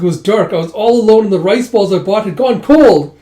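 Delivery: high, loud aggrieved whine; indignant complaint. Acted. Yun complains to the group about being alone. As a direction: pour out the grievance.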